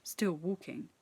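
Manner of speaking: plain reading voice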